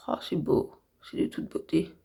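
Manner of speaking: awed whisper. Real, not acted. Admiring the finished eyeshadow.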